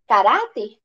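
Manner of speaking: ordinary delivery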